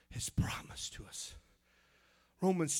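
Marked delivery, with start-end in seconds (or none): 0.1-1.3 soft whispered emphasis. Real, not acted. Preacher hushing the congregation on God's promise. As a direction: drop to a hush on the promise.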